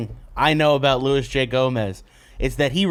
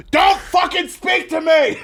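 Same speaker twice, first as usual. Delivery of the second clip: hammed-up angry shout, pitched higher; mock furious yell; 13 semitones higher, 3 dB louder than usual. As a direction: blow up at a friend, much higher and full voice. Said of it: "And then you're like".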